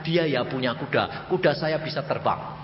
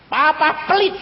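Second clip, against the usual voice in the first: put-on childish accusation; 13 semitones higher, 5 dB louder than usual. Child scolding stingy father.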